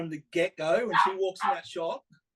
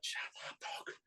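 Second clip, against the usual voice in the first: whispered quote; 16 dB quieter than usual. Retold or imitated. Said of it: "And she's like".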